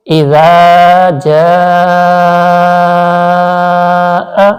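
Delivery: slow chanted tajweed recitation; Quran recitation. Shown as a demonstration. Teacher demonstrating recitation to students, slow and chanted. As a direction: draw out each syllable in melodic recitation. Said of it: "تو کیسے پڑھیں گے؟".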